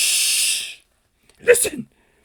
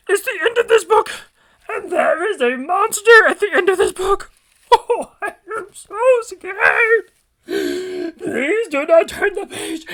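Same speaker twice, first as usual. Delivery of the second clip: high, loud, slow pleading in mock terror; scared Grover; 7 semitones higher, 8 dB louder, slower than usual. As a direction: beg the reader not to turn the page. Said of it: "my Grover".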